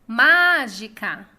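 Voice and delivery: "Mágica" called loudly, MÁ drawn out; calling out the word. Shown as a demonstration. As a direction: call out "Mágica", holding a loud MÁ. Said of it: "esse exercício de chamar as palavras".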